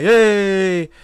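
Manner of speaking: loud, drawn-out mock greeting; mock hail. Pretending to show up in Cali, loud drawn-out greeting. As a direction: call out a long hey.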